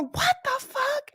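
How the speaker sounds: very high, slow mock disbelief; mock disbelieving wife. Wife reacting to husband's act, high mock disbelief. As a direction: imitate a wife's stretched, high-pitched shock.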